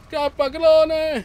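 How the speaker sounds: high, drawn-out loud yell; urgent shouted warning. Real, not acted. Player warning the clone, urgent high shout. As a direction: call out to make a friend flee.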